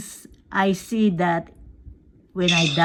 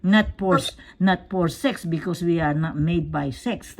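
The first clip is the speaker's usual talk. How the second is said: usual speaking voice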